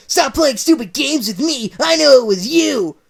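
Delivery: loud, high furious rant; ranting Sonic. Acted. Sonic angry.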